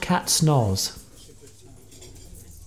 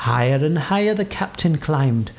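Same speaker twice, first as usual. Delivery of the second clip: plain reading voice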